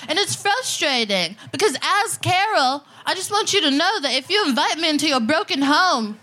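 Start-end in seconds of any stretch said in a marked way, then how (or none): none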